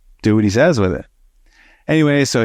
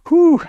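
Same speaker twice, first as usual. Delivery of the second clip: high-pitched "whew" of relief; acted phew, pitched way up; 4 dB louder than usual. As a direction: blow out a high, whooped "whew". Relief after the retold confrontation.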